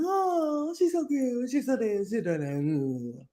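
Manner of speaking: put-on cooing over a baby, slowing at the end; gushing coworkers. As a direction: coo like coworkers seeing a cute baby. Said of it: "everybody's like".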